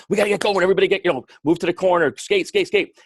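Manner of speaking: high, loud, pumped-up rink calls; hyped coach barking drills across the rink; high-energy coach. Retold or imitated. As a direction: fire up the kids on the ice. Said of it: "I try to have a lot of energy".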